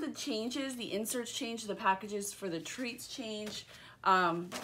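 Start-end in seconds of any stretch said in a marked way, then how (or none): none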